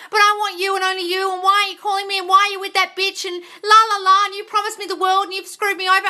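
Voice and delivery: high, run-on mimicked nagging; mock pleading complaint. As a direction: imitate a needy partner's tirade. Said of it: "Messaging him all the time and saying".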